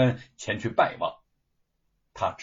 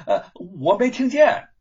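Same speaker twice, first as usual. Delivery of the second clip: feigned deafness; 8 semitones higher, 7 dB louder than usual. Pretend. Pretends not to hear.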